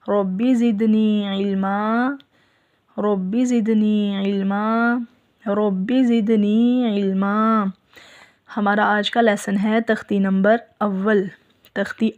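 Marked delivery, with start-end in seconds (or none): 0.1-7.7 slow chanted prayer, each phrase drawn out; recited dua. Shown as a demonstration. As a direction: chant it slowly three times like a prayer. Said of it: "दुआ पढ़ लीजिए पहले". (धीमे सुर में दुआ पढ़ना)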